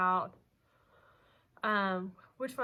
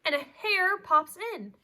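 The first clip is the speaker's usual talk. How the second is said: lively storybook voice, big swings of pitch and loudness; animated read-aloud; reading to children; 5 semitones higher, 9 dB louder, slower than usual. A narrator's reading. Teacher reads picture book aloud.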